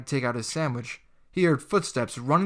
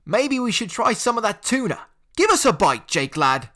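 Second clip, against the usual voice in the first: high, loud teasing banter; jeering Yorkshire lad; bantering bully; 8 semitones higher, 6 dB louder than usual. Acted. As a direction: egg the gang on. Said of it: "joining in on the banter".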